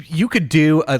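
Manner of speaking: ordinary delivery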